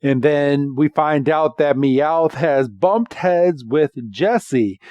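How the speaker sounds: usual speaking voice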